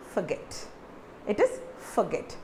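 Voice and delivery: forget with the for like for; correct pronunciation. Shown as a demonstration. Teacher models the right forget for students, careful pronunciation. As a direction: say forget with an open for. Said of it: "So it is not forget".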